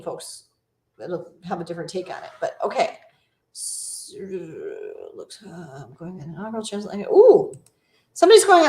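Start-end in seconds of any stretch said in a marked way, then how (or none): 3.5-7.1 very soft, low mumble; reading under breath. Real, not acted. Streamer skimming a viewer's comment, reading under breath. 7.1-8.7 high, loud burst of delight; pleasantly surprised. Real, not acted. Streamer spotting a viewer's cruise news, pleasantly surprised.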